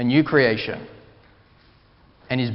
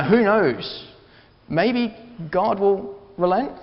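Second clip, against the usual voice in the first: higher, questioning lilt, slowing at the end; unsure, tentative; 6 semitones higher, slower than usual. Acted. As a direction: voice their faint hope as an open question. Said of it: "they're not even sure".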